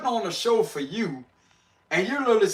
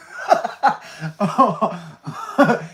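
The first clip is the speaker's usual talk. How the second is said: real amused laugh. Real, not acted. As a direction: burst out laughing. Laughing at the phrase.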